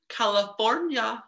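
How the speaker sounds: high slow California; odd drawn-out California. Real, not acted. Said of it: "Don't know why I said it like that".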